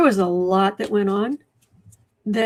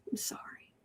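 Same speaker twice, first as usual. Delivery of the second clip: a barely voiced whispered apology; whispered sorry; 21 dB quieter than usual. Real, not acted. To Rebecca after her story, a whisper.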